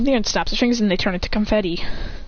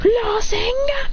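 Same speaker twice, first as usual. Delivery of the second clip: high, drawn-out battle cry; mock anime finishing yell; attack shout; 12 semitones higher, much slower than usual. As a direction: stretch the move's name as you strike. Character shouts finishing move.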